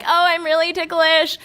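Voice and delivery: usual speaking voice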